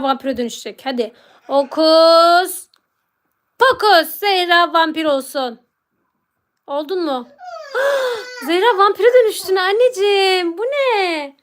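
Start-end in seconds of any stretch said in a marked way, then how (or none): 1.5-5.6 playful drawn-out incantation; stretched-out mock magician's chant; magic spell. Casts pretend spell turning Zehra vampire. 7.3-8.4 high, theatrical gasp; sharp playful intake of breath; mock shocked gasp. Pretend shock at vampire transformation. 8.5-11.4 hammed-up astonishment at a vampire; drawn-out theatrical surprise; mock shock. Pretend shock at child turned vampire.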